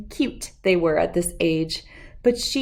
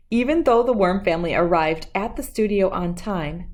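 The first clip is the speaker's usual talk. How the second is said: plain reading voice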